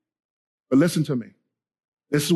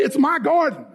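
"my garden" pitched high and firm; high rallying call on "my garden"; emphatic declaration; 8 semitones higher than usual. Shown as a demonstration.

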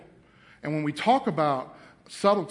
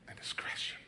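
whispered; 17 dB quieter than usual. Real, not acted. Preacher reading aloud, whispering the last word. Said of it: "whispered reading word".

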